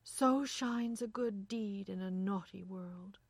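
ringing, unhurried exclamation; exclaimed. A narrator's reading. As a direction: declaim it slowly and loudly. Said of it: "she exclaimed".

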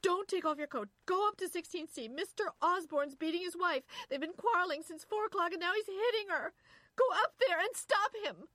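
high, loud frantic screaming; screamed. A narrator's reading. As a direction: scream it in a panicked rush. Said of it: "she screamed".

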